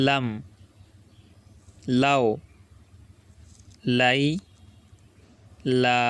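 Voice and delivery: single syllables, each held apart by long pauses; slow syllable drill. Shown as a demonstration. Quran tutor reads syllables for learners to echo. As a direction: read each sound out slowly for students to repeat. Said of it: "এখন বানান ছাড়া বলবো".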